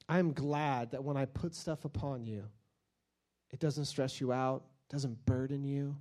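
soft, tender crooning to a table; hushed mock lover serenading a table; mock doting love talk. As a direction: whisper sweet nothings to your table, tongue in cheek. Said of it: "just said".

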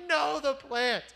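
high-pitched pretend wail; exaggerated cry of loss; mock anguish. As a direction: lament it high, hammed-up. Mocking Jonah's grief over the plant.

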